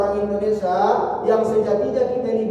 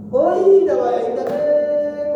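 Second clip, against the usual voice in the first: higher sing-song mock groan, slow; mock dismay; 7 semitones higher, 4 dB louder, slower than usual. Preacher acting a farmer annoyed by rain, mock sing-song groan. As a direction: act out the disappointment, half-sung.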